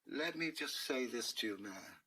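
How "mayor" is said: ordinary delivery, nothing marked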